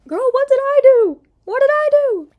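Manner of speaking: very high, loud shrieking disbelief; hammed-up indignant yell; outraged shriek. Real, not acted. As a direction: scream it in mock outrage. Outraged mock reaction.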